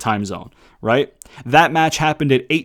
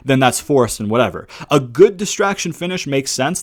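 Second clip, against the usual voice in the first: ordinary talk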